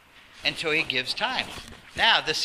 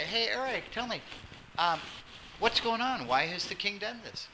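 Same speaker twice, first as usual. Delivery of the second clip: softer, gentle, calm inquiry; the calm way Daniel actually asked; calm asking; 3 semitones higher, 8 dB quieter than usual. Shown as a demonstration. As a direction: ask quietly, like a friend who wants to understand. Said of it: "or you could say".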